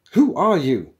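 loud, high indignant challenge; angry. A narrator's reading. Programmer rising to confront intruders, angry. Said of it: "rising angrily from his seat".

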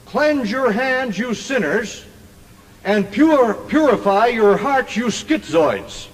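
slow, drawn-out preaching with pauses; slow scripture quote. Acted. Preacher quoting James to the crowd in his own wording, slow and deliberate.

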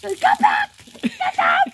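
high-pitched warrior dog voice; playful defiance. Pretend. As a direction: challenge them in a high play voice. Voicing a defiant dog in chase.

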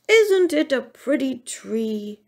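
sleepy storybook bear voice; drowsy yawn. A narrator's reading. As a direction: say it through a touch of a yawn. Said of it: "yawned Barnaby quite drowsily".

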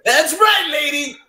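high, loud imitation of a proud kid; piercing mock child's proclamation; full-voice announcement. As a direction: shout it out like a small boy bragging. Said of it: "full voice goes".

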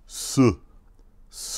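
s with a short, tight vowel after it, "suh"; Mandarin four. Shown as a demonstration. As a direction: hiss the s into a clipped "suh". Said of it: "this is how you pronounce the number four".

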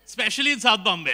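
ordinary delivery

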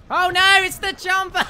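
sudden high startled cry; alarmed shriek. Real, not acted. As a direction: shriek as the enemy appears. Enemy found him.

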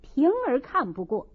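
plain reading voice

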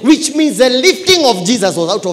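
high, loud sermon climax; full-force preaching. Real, not acted. Preacher tells church humble yourself, full-force preaching. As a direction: punch out the lesson at full voice.

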